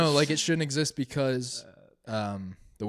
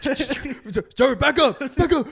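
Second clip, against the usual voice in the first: much higher, louder command; cop shouting; 13 semitones higher, 9 dB louder than usual. Acted. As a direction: bark at him to back off. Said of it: "I'm a cop".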